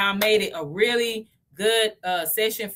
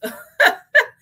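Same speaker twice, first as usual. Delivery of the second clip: embarrassed laugh; 8 dB louder than usual. Real, not acted. Laughs at own tongue slip.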